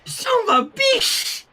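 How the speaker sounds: put-on high, slow toddler voice; gleeful baby voice repeating a bad word; little child piping a curse.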